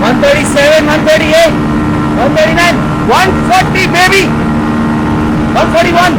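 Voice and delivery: loud, high, climbing speed callout; excited shouting. Real, not acted. Calling out rising top speed.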